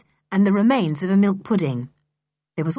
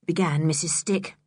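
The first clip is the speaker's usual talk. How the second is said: usual speaking voice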